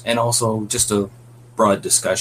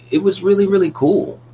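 excited; 12 semitones higher, 5 dB louder than usual. Real, not acted. Host gushing about the film.

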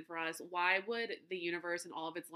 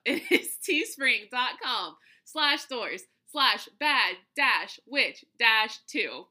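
slow, laughing second try at the link; slow dictation; 2 semitones higher, 8 dB louder, much slower than usual. Real, not acted. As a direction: read the address out slowly, laughing. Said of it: "nope, I messed it up".

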